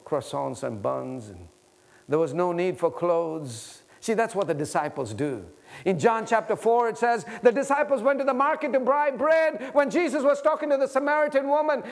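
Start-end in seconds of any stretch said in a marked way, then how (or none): 5.8-11.8 raised, driving scripture retelling; impassioned preaching. Real, not acted. Preacher retelling the disciples at the market, impassioned preaching. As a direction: lift the voice high and press on urgently.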